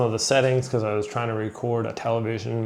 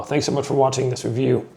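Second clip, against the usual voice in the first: hoarse voice; 2 semitones higher than usual. Real, not acted. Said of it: "Losing my voice".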